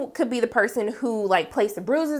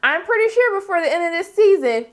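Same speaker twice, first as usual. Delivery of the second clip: raised pitch, knowing tease to Cal; high teasing warning; 6 semitones higher than usual. Retold or imitated. Recapper warns Cal his turn is coming, high teasing voice. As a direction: wag a finger at him with your voice. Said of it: "But I was like".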